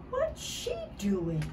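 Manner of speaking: ordinary delivery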